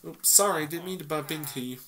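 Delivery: ordinary talk, nothing marked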